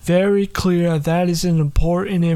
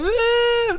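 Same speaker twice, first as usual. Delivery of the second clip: put-on very high squeaky old-lady eh; high granny whine. Granny's high whining note. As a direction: squeak it like a fussy grandma. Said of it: "they hit a new note".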